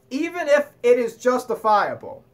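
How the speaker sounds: normal talking voice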